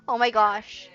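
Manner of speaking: loud startled gasp of words; loud sudden fright. Acted. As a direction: jolt awake loud and sudden. Jolted awake by knocking.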